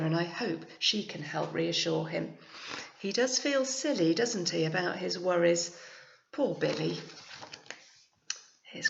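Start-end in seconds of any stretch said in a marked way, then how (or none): none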